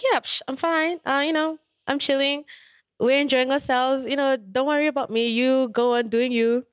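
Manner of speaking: high, airy pretend nonchalance; pretending all is fine while hurting, breezy and high; fake breezy. Faking being fine, retold.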